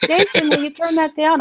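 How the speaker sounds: high mock wife voice asking; wife asks Jason to turn it down, voiced very high; imitating Rachel. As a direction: pitch way up like the wife nagging. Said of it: "when Rachel says".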